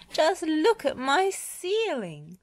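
high, slow wail of dismay; dismayed exclamation. A narrator's reading. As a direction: lament the damage in storybook alarm. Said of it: "exclaimed Missus Potts".

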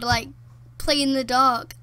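usual speaking voice

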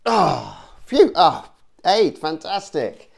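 loud, relieved cheer at the dice; yelling at a horse race. Acted. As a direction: shout it out, then slow down in triumph. Dice roll comes up eight.

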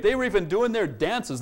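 ordinary talk

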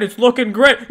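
usual speaking voice

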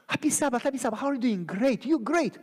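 high, sing-song imitation of pleasantries; mock cheery greeting. Churchgoers pretending all is great, mock cheer. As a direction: chirp it to mask the truth.

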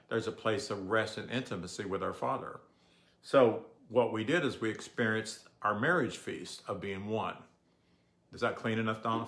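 normal talking voice

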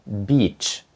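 "Beach" with a long soft ee like Ukrainian І. Shown as a demonstration. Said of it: "має м'який звук І".

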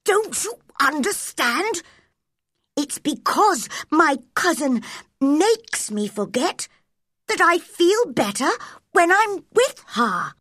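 put-on high, haughty young prince; imperious prince's voice. A narrator's reading. Boy tells doctor off, imperious prince's voice. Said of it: "in his prince's voice".

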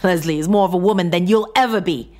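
heated, scornful retort; spitting contempt. A narrator's reading. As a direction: spit it out to wound. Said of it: "John spat".

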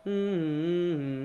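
beatbox background hum; longer hummed note. Shown as a demonstration. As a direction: hum the long synth line slowly. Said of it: "one of them's longer, so it goes".

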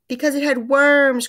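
high, loud crowing shout; gleeful triumphant cry. Acted. Missus Twit reveals the worms to her husband, loud gleeful cry. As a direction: crow it out in triumph. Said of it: "cried Missus Twit".

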